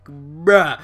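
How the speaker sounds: ordinary talk, nothing marked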